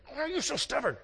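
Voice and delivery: high-pitched, hammed-up angry rant; mock scolding. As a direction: scold her in a high, mock-nagging tirade that runs into gabble. Imagined husband scolding his wife.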